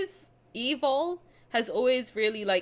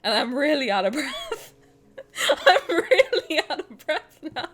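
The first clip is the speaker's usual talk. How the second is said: laughing and gasping, louder; breathless laughing; 6 semitones higher, 4 dB louder than usual. Real, not acted. Apologizing to chat while laughing, out of breath. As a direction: giggle through the lack of air. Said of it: "you caught me when I'm out of breath".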